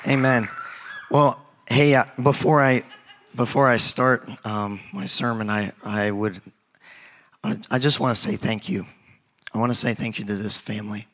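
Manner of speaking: ordinary delivery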